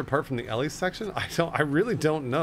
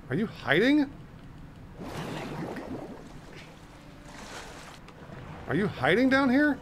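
sing-song calling; 8 semitones higher, 4 dB quieter than usual. Acted. Calling out to hidden character.

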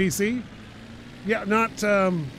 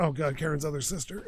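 normal talking voice

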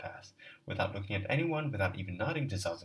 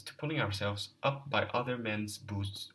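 ordinary delivery, nothing marked

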